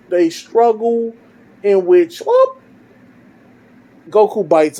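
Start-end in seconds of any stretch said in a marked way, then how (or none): 2.2-2.6 sudden very high whoop; quick high-pitched comic whoop; whoop sound effect. Retold or imitated. Storyteller marking Goku's sudden bite, comic whoop.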